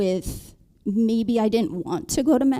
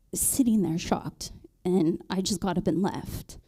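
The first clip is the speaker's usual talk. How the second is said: ordinary delivery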